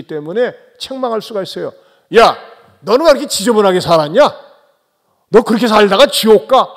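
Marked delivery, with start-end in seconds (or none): 2.2-6.7 louder, fast, scolding a sinner; Jesus imagined scolding the woman at the well, loud and fast; harsh rebuke. Pretend. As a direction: tear into her for how she lived. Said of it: "엄청나게 책망을 하고".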